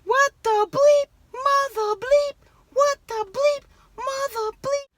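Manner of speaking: high, loud yelled curses; reenacting yelling at a burglar in the van. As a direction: scream the curses at the intruder, pitch way up.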